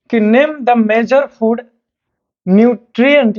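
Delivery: slow, measured question reading; dictation pace. Acted. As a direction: read slowly for students to copy. (धीरे-धीरे सवाल लिखवाना)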